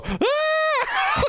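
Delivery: high mock scream of a campy hero, through a laugh.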